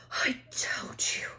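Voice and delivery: breathless whispered gasp. A narrator's reading. Hermione gasping to Harry after the run, breathless whisper. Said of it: "Hermione gasped".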